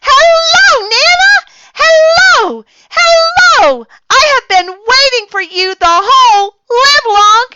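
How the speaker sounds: very high, loud, squealing greeting; little girl squealing hello to Nana; overjoyed greeting. A narrator's reading. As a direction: squeal it while hugging her.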